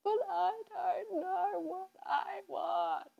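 high, slow, teary wail; crying. Retold or imitated. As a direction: let the voice crack as if in tears. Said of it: "and I was crying".

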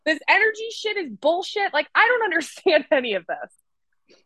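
high, laughing exasperated rant; the listener at their wits' end, squeaky and laughing; frustrated you. Retold or imitated. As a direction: whine like it's all bullshit. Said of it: "you're literally like".

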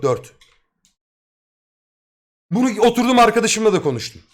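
ordinary delivery

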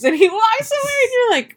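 normal talking voice

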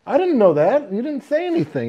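high, loud indignant protest; defensive protest. Retold or imitated. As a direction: push back, offended.

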